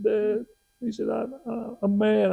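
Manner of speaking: high-pitched mock child, upset; whimpering kid, played for laughs; tearful little boy. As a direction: imitate an upset young son. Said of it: "he got tears running his face".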